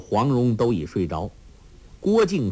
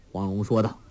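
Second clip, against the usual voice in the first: usual speaking voice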